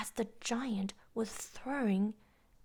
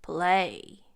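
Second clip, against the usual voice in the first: loud lower command; giant railing; 4 semitones lower, 9 dB louder than usual. A narrator's reading. Giant ordering the harp to play, loud and low. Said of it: "railed the giant".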